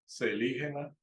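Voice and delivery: "celígena" with an s sound at the start; suggested pronunciation. Shown as a demonstration. Teacher passing on someone's correction, suggested pronunciation. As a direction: say "celígena" as se-LI-hena. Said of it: "sino que se decía".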